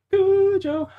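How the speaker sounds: Cujo in a very high squeak; squeaky mock voice. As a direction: squeak Cujo to tease himself. Mocks his wrong version.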